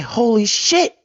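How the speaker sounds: high-pitched retold amazement; stunned reaction. Man recalling first seeing his portfolio money, stunned. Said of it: "When I saw the money in my portfolio".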